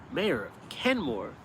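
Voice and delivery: usual speaking voice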